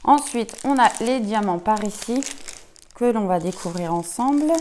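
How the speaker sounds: normal talking voice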